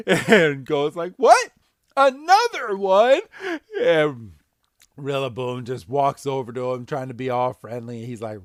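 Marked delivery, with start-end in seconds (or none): 1.2-3.2 very high, loud "what"; startled shock. Retold or imitated. Go seeing another Rillaboom, a high startled yelp. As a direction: yelp like a cartoon kid caught off guard.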